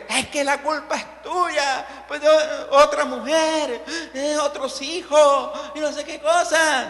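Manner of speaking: high, drawn-out wailing complaint; quarrelling wife. Acted. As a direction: act out a wife blaming her husband. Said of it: "comenzó a pelear con el esposo".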